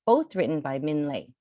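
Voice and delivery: ordinary delivery, nothing marked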